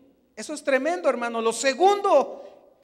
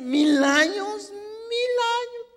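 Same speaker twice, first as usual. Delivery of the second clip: slow, stunned repetition rising higher; disbelief; 8 semitones higher, slower than usual. Retold or imitated. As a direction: stretch it out in disbelief. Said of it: "decía". (incredulidad lenta, la segunda más aguda)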